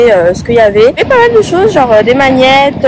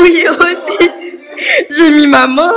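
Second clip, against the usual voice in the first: laugh-crying; 6 semitones higher, slower than usual. Real, not acted.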